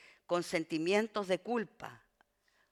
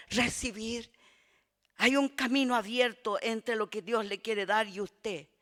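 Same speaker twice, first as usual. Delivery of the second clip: louder, higher fervent preaching; loud impassioned pulpit preaching; preaching at full force; 4 semitones higher, 8 dB louder than usual. Acted. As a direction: preach it out to the congregation.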